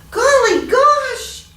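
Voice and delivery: high, loud, slow cry of surprise; startled exclamation. Acted. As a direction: jolt awake and exclaim. Farmer wakes startled.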